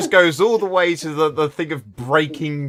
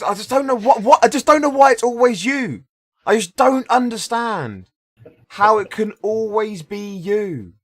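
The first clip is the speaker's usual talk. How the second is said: exasperated rant; 8 semitones higher, 5 dB louder than usual. Real, not acted. GM exasperated at player.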